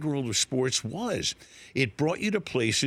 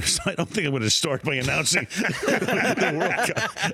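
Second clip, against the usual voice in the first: laughing through it; 4 semitones higher than usual. Real, not acted. Laughing at own soccer answer.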